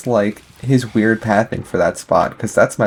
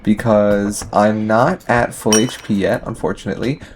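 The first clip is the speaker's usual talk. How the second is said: ordinary delivery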